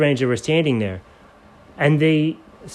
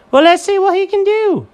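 squeaky Jenkins; 5 dB louder than usual. Acted.

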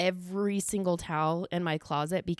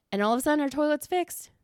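excited; 7 semitones higher, 4 dB louder than usual. Real, not acted. Bragging toilet fixed.

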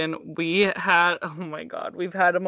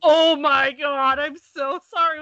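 louder, very slow shocked gasp of sorry; horrified apology; 7 semitones higher, 5 dB louder than usual. Retold or imitated. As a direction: stretch it out like a guilty parent. Parents horrified by movie scene.

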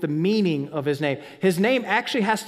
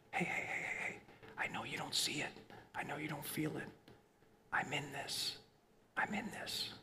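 very soft, reassuring whisper; tender whisper; 5 semitones lower, 19 dB quieter than usual. Acted. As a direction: whisper reassurance as God, close by. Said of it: "He just whispers".

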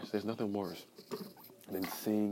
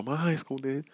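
higher, louder mock objector; mocking imitation; 7 semitones higher, 7 dB louder than usual. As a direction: parody the smug objector. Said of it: "a lot of people are gonna go".

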